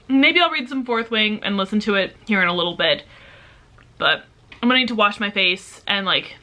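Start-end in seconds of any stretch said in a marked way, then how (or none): none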